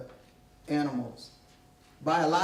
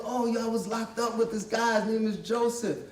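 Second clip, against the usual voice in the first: excited ex-inmate; 5 semitones higher than usual. Retold or imitated. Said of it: "so the guy goes".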